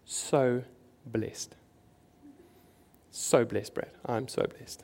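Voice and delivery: soft, airy, almost whispered imitation of the friend; hushed, breathy "so blessed". Rich friend on the phone to the pastor, hushed and breathy. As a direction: breathe the words out low like a smug secret. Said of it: "And he just goes".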